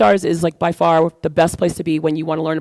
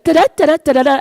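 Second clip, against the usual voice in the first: high, louder mock rattle of a chef mom; imitating mom rattling off; 8 semitones higher, 5 dB louder, faster than usual. Chef mom listing lasagna ingredients, high mock rattle. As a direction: rattle off the recipe like an impatient mom.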